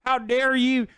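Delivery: whiny caricature of an offended voter; mock someone acting wounded and defiant; dumb Republican. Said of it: "a dumb Republican who's like".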